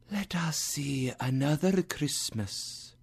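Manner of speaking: soft, high ghostly whisper; whispering spirit. Acted. Spirit leads Scrooge to another scene, whispered. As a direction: whisper like an otherworldly guide.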